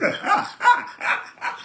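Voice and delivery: a high, breathy laugh. Real, not acted. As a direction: let out a high airy laugh. Laughs after his joke. (rire aigu et soufflé)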